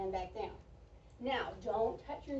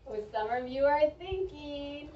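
ordinary talk

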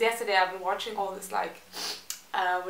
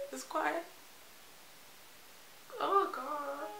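"it's quite" softer and higher, breaking into tears; choked up; 6 semitones higher, 11 dB quieter, slower than usual. Real, not acted. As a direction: let "it's quite" catch as the tears come. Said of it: "Why am I crying?".

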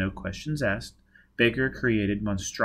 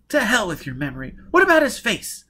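high, rising to a loud demand; exasperated, pitch leaping up; impatient outburst; 11 semitones higher, 6 dB louder than usual. Acted. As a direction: burst out, fed up with the boasting. Jiz impatiently cuts off boasting.

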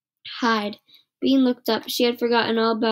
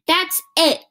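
loud, high fed-up shout; angry yell; 7 semitones higher, 5 dB louder than usual. Acted. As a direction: lose patience, loud. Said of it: "Nancy yelled".

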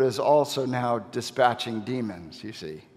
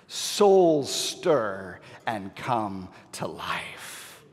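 slow, hushed climax; 6 semitones lower, much slower than usual. Real, not acted. Preacher tells of souls waking, slow and weighty.

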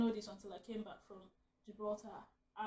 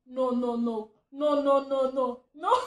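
rising frantic pleading, louder; panicked no no no; 2 semitones higher, 10 dB louder, slower than usual. Retold or imitated. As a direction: beg the monkey to stay away, loud and fast. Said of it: "I was there saying".

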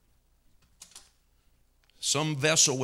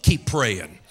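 ordinary talk

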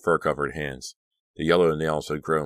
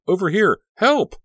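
very high, loud cries for help; frantic full-voiced calling; shouting; 9 dB louder than usual. A narrator's reading. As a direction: yell desperately to be seen. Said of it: "she shouted".

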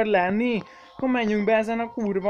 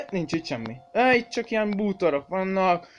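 ordinary talk, nothing marked

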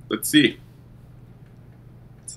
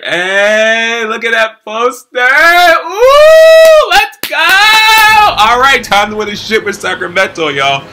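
much higher, louder hype yelling; excited hype; 13 semitones higher, 12 dB louder, slower than usual. Real, not acted. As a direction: scream with joy at the new poster. Hyped over new poster.

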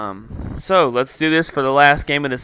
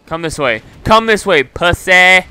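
loud, high taunt; yelled real taunt; 7 semitones higher, 9 dB louder than usual. Real, not acted. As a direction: goad the enemy at full voice. Taunting an enemy.

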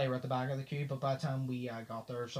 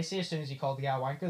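ordinary delivery

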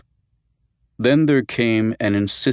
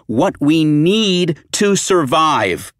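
slower, higher, hammering the words; angrier repeat; 9 semitones higher, 7 dB louder, much slower than usual. Acted. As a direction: drag out the repeat in fury.

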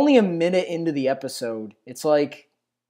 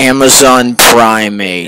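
louder, slow, drawn-out disbelief; incredulous echo; 6 semitones lower, 12 dB louder, slower than usual. Real, not acted. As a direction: repeat the pun slowly, amazed. Echoes the pun in disbelief.

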